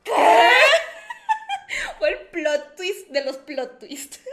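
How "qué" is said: very high, loud, drawn-out shriek; shocked father's scream. Retold or imitated. Dad hears second daughter has boyfriend, screaming in shock. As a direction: explode in hammed-up disbelief. Papá escucha la segunda confesión.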